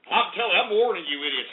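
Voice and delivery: normal talking voice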